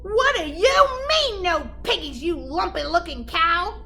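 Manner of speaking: high, loud furious screech; screaming witch. Acted. Gritch screaming at the cow, high furious screech. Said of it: "screamed Gritch".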